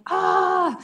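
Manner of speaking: ordinary talk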